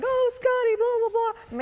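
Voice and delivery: very high, excited squeal, retold; gushing mock greeting. Actress retelling her reunion with the gate guard, excited high gushing. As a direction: gush it in a high put-on voice.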